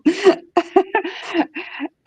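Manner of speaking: high burst of laughter. Real, not acted. Laughing at his text.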